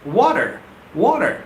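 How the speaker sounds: "water" twice with a full r ending; model word. Shown as a demonstration. Teacher modelling the word for viewers. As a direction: repeat "water" cleanly, ending on r. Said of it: "the right pronunciation is water".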